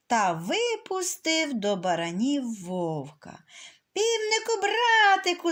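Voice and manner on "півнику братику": wolf overjoyed. Acted. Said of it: "зрадів вовк".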